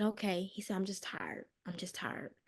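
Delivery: faint, drained murmur; fading out with exhaustion; exhausted whisper. Retold or imitated. Exhausted husband repeating himself.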